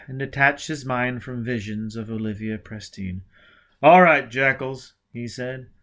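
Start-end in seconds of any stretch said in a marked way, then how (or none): 3.8-4.9 defiant challenge. Acted. Hero faces the gang.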